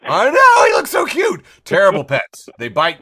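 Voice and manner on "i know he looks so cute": high, loud excited squeal; squealing cute voice. Real, not acted. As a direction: gush over how cute it is. Squealing over the cute lemur.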